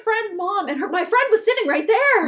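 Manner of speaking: loud, high indignant telling; bursting out in disbelief; indignant outburst. Real, not acted. Telling a friend about the rude mom, loud and indignant. Stressed outrage retelling.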